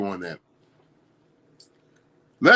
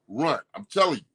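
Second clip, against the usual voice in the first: ordinary delivery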